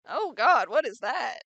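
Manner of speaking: sudden loud shocked cry; jump-scared yelp of surprise; startled scream. Real, not acted. Startled by something scary on screen.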